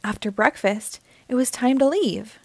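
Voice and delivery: plain reading voice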